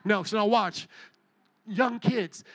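ordinary talk

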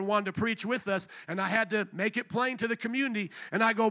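ordinary talk, nothing marked

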